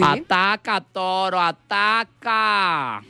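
high, slow camp taunting of the bull. Acted. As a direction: tease the bull, drawn out. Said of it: "la loca".

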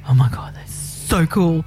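hushed, breathy "so cool"; retold awe. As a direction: imitate your own amazed reaction, half whispered. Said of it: "And I was like".

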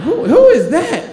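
put-on high, slow, drawn-out teen girl question; nosy girl. Girls at the party stare at the ninja, high drawn-out question. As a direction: squint and ask who that is.

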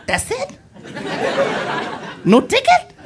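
high squeaky question; mock disbelief. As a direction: squeak it out, rising sharply in pitch. Acted disbelief at being let off.

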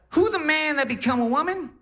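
put-on very high, brash Def Jam comedy accent; grandma's crazy accent. Grandma on morphine greeting her grandson, high brash comic accent. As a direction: imitate a Cat Williams style accent. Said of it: "talking in a crazy accent".

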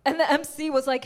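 ordinary talk

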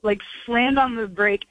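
normal talking voice

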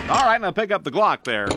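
usual speaking voice

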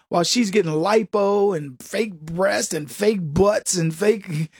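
usual speaking voice

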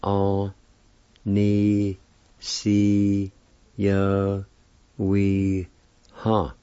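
An-ni-si-yo-wi-ha drawn out very slowly, each syllable separate; teaching An-ni-si-yo-wi-ha syllable by syllable; Cherokee word, syllable by syllable. Shown as a demonstration. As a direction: sound out An-ni-si-yo-wi-ha one syllable at a time, very slowly. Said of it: "is pronounced like this".

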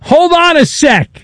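high, drawn-out protest; calling a halt. Real, not acted. Host halting an eager caller, high and insistent.

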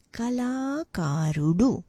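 "Kalakarudu" slowly, with long second and third vowels; syllables put together. Shown as a demonstration. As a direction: stretch the "la" and "ka" in "Kalakarudu". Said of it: "put together".

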